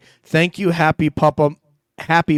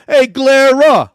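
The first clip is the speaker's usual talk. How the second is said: loud, high, hammed-up New York accent greeting; 11 semitones higher, 11 dB louder than usual. Reading a viewer's super chat to Glera, in a New York accent. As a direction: belt it out like a brash New Yorker in a New York accent. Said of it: "I wanna say this in my New York accent".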